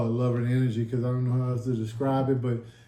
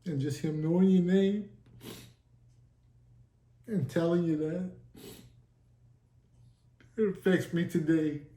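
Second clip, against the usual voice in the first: higher, halting, holding back tears; choked up, with long pauses; real tearing up; 4 semitones higher than usual. Real, not acted. Man moved by Jesus knowing his name, tearful. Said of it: "tearing up now, trying not to cry now".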